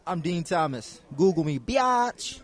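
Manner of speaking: loud, slow, swaggering boast; cocky trash talk. Pretend. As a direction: drag out the taunt with swagger. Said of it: "he said it a lot better than I did".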